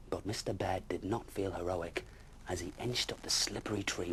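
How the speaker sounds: ordinary delivery, nothing marked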